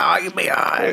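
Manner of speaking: gruff, dismissive put-on voice; imitated pa. Pa shrugs off the grandson's injury, dismissive. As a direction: wave it away like an unbothered pa. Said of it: "my pa was like".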